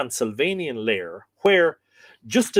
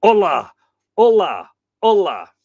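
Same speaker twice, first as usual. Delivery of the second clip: ghastly wailing cry; 6 semitones higher, 10 dB louder than usual. Acted. A Martian's ghastly cry floating across the ruined city, wailing loud. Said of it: "a ghastly, wailing cry".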